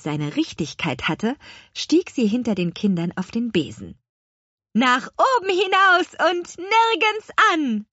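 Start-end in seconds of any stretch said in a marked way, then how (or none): 4.7-7.9 high, ringing take-off cry; calling out. A narrator's reading. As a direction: call out the flying command high and drawn. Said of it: "rief sie". (hoch gerufener Flugbefehl)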